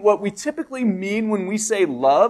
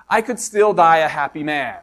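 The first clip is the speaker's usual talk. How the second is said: ordinary talk, nothing marked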